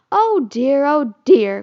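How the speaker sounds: loud exasperated oh dear; cried out. A narrator's reading. Wendy crying out at the tattling children. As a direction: cry out in dismay. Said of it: "cried Wendy".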